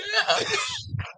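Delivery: high shrieking laugh; laughing hard at the story's punchline. Real, not acted. Loud laugh after punchline.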